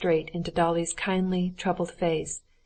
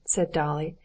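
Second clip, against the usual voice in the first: plain reading voice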